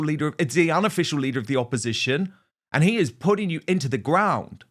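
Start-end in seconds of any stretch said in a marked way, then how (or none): none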